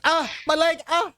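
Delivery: high-pitched mock cries of pain; hammed-up injured wail; wounded thug. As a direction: whine and yelp in pretend agony. GM voices the wounded man.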